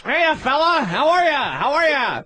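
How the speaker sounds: glad-handing nightclub comic. Retold or imitated.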